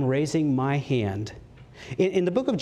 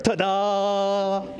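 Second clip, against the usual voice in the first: showy magician's "Ta-da!", pitched higher; 6 semitones higher than usual. Retold or imitated. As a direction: announce it like a stage magician revealing a trick. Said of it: "when someone does a magic trick".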